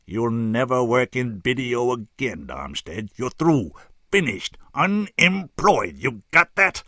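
slow, loud, high-pitched fury; raw hatred. A narrator's reading. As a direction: pronounce his doom, hammering each verdict. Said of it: "looked at me with raw hatred".

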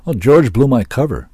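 loud, lifted, breezy admission; cheerful. A narrator's reading. Finnegan explains how his cover was blown, cheerful. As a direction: say it cheerfully, untroubled. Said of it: "Finnegan said cheerfully".

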